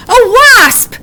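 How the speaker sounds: high, loud startled cry; alarmed cry. A narrator's reading. Wombat crying out as a wasp whooshes past. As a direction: yelp out the danger. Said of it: "she cried".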